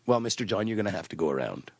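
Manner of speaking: very low, hushed operator voice; OnStar operator. Acted. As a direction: break it to the caller low and softly. Skit, operator answers the caller.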